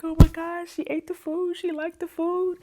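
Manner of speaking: high, mock-tearful amazement; retold happy crying. Cook learning her food was liked, imitated happy tears. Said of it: "I started crying".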